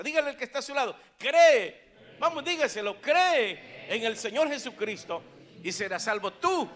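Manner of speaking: loud, high preacher's proclamation; full-force preaching. Acted. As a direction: belt out the key words to the crowd.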